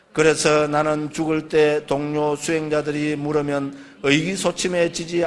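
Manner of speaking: slow, low, measured chanting of scripture; solemn recitation of the Buddha's words to monks; sutta recitation. Acted. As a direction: intone the text like a monk reciting. Reads the sutta aloud.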